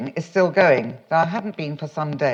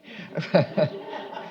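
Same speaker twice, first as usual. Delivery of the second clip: soft unvoiced chuckle at the slip; breathy laugh; 5 dB quieter than usual. Real, not acted. Caught out by her own misreading, a soft breathy laugh. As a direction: laugh quietly at yourself.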